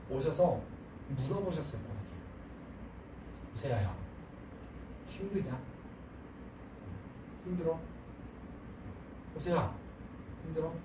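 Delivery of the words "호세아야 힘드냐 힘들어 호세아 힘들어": tender God. Retold or imitated. God kneels by weary Hosea, soft and tender. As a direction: gently ask him if he is tired.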